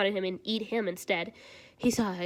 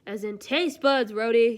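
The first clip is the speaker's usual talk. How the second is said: glaring; 8 dB louder, slower than usual. Acted. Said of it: "he glares".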